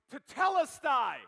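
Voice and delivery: slow, loud, high triumphant cry. Acted. Jesus declaring victory from the cross, loud and slow. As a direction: proclaim victory with everything left. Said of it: "Jesus says with a loud voice".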